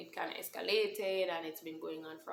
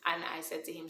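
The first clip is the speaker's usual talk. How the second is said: usual speaking voice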